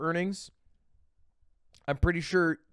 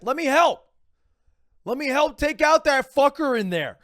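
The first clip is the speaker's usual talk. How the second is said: higher and louder, pushy fake hero; mock eager yell; 10 semitones higher, 8 dB louder than usual. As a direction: beg the cops loudly to let you help. Imagined eager plea to cops.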